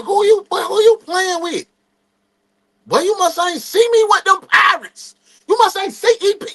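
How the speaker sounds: high, loud trash talk; teen yelling a challenge before a race; cocky shout. Retold or imitated. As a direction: holler it at your dad. Trash talk to dad at track.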